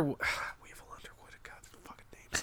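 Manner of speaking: real embarrassed whisper. Real, not acted. Speaker too embarrassed to say the name aloud, whispering. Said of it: "I hate saying that out loud".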